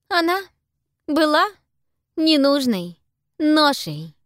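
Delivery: high, slow and cold; cold girl voice. A narrator's reading. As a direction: drag each word out coldly. Pippa's cold confession.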